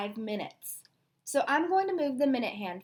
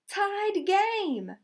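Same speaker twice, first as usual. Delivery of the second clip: much higher excited shout; yelling; 8 semitones higher, 3 dB louder than usual. Acted. Referee Jake announcing the tie, a high excited shout. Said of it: "yelled Jake".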